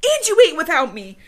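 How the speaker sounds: high, loud mock accusing; mad accusation. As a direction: accuse your partner with acted outrage. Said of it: "she wants to be mad".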